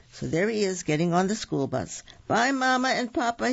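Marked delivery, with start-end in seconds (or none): 2.3-3.5 put-on high, singled-out child character voice; high-pitched storybook farewell; little wolf's goodbye. A narrator's reading. Little wolf waves off his parents.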